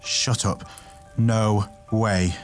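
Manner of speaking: breath-led character outburst; blurted disbelief. A narrator's reading. Tay rejects Nasteri's theory, blurting. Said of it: "Tay blurted out".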